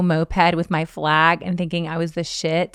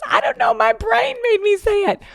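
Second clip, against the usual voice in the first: put-on high-pitched little-kid whine; imitating a small child, pitched way up; child's excuse; 13 semitones higher than usual. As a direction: plead helplessly like a four-year-old. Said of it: "He goes".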